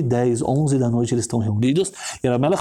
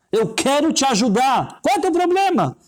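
insistent plea; 11 semitones higher than usual. Acted. Rabbi urging a praying stranger to open up, insistent plea. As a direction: press the offer hard, voice pitched way up.

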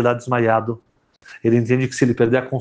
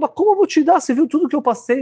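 outraged protest; faster than usual. Acted. Yehudi protests his suffering.

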